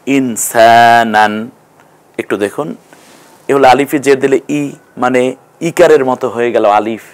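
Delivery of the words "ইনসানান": ইনসানান drawn out slowly in Quranic style; slow Arabic reading. Shown as a demonstration. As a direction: read ইনসানান slowly, syllable by syllable. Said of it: "এইটা দেখুন". (ইনসানান ধীরে টেনে আরবি উচ্চারণে)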